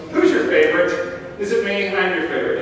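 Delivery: playing a kid angling to be the favorite; mock needy kid. Pastor mimics children asking a parent, mock childish pleading. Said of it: "when your kids go".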